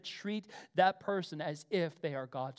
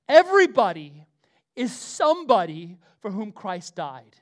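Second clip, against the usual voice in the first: proclaimed; 14 dB louder than usual. Acted. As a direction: boom it out to the back row. Proclaiming the key line.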